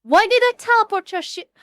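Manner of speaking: high, loud baffled outburst; exasperated surprise. Real, not acted. Streamer baffled at teleporting friend's things, exasperated outburst. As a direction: yelp it in exasperated disbelief.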